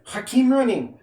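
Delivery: 'Hakeem Ronin' said high, in a hammed-up Norwegian accent; mock Norwegian accent. Shown as a demonstration. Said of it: "That's how you say it in Norwegian".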